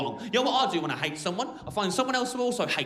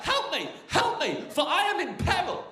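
goose crying for help; 10 semitones higher than usual. Acted. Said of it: "He cried out".

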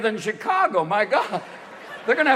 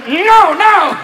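high, loud cry of denial; hammed-up panicked denial; mock frantic protest; 7 semitones higher, 7 dB louder, slower than usual. Old boss denying an affair with a page, mock frantic.

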